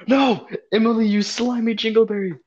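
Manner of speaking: high melodramatic outcry; mock anguish. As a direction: wail it in hammed-up grief. Acted outcry at dying Emily.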